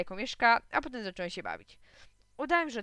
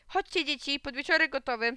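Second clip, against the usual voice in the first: higher, raised voice of a mum calling; 5 semitones higher, 4 dB louder than usual. A narrator's reading. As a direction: call the children in from the garden. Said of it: "wyszła z domu mama i zawołała". (mama woła dzieci podniesionym głosem)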